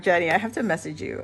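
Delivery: ordinary talk, nothing marked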